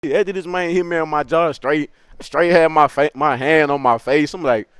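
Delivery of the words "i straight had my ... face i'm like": plain reading voice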